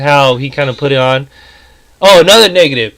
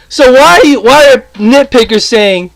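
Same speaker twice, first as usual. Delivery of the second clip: heated rant; 6 semitones higher than usual. Real, not acted. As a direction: build the outrage through the comparison. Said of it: "I'm about to get really pissed off".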